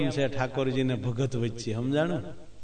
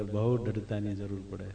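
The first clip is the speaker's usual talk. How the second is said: soft echo; 6 semitones lower, 7 dB quieter than usual. Shown as a demonstration. Preacher repeats his point to the listeners, softly. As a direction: repeat બહુ દ્રઢતાની જરૂર પડે much softer and lower.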